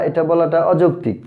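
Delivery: normal talking voice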